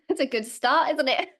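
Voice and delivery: high, loud, laughing aside; real giggle breaking into the words; amused self-mockery. Real, not acted. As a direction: laugh at your own slip, pitch jumping up. Laughs at fumbling her opening words.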